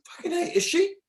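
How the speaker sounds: very high, squeaky disbelief; high-pitched incredulous delight, breaking toward laughter; retold amazement. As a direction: marvel giddily that someone is joining you.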